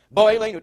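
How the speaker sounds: "Bo" in "Bo Eleinu" hit loudly; stress on first syllable. Shown as a demonstration. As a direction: put the accent on "Bo" in "Bo Eleinu". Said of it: "the accent is on the Beis".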